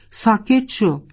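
furious cry, ordering the friend away; angry shout. A narrator's reading. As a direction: yell at her to go away. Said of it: "فریاد زد".

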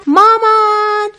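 high, drawn-out scream for mom; frightened scream. A narrator's reading. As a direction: cry out for mom in terror from a nightmare. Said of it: "کیتی فریاد زد". (فریاد بلند و کشیده از ترس)

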